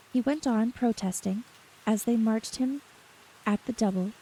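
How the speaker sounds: plain reading voice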